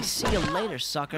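a mild gloating taunt, slightly high; mildly gloating quip; imagined taunt. Retold or imitated. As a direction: toss off the victory line. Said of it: "pull out the shotgun and say".

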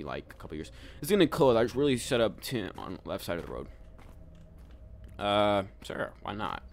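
ordinary delivery

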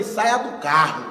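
low and drawn out, deadpan; slow deadpan order. Retold or imitated. Comic tells the fan driver to get out, slow deadpan. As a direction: say it slowly like a dry joke.

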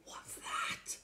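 frightened hushed question; scared whisper. Real, not acted. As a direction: whisper it in fear. Reader reacts, hushed.

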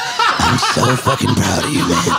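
a hint of a slurring bro, laughing; lightly voiced drunk friend; imitating the friend. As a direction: quote the friend through a laugh. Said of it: "is like".

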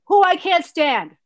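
high, loud, slow emphasis; emphatic dislike. Real, not acted. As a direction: draw it out loudly with open distaste. Emphatic repeat of soap dislike.

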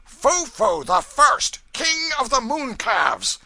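very high, slow, loud shriek; shrieked suggestion. A narrator's reading. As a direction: shriek the title out across the room. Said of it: "A tinker shrieked out a suggestion".

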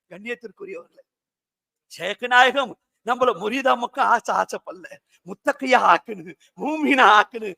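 ordinary talk, nothing marked

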